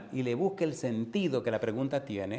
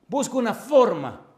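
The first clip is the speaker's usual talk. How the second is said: higher and louder, slow; urgent appeal; 9 semitones higher, 10 dB louder, slower than usual. Acted. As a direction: cry it out to the crowd. Preacher's peak of the plea. (ruego urgente)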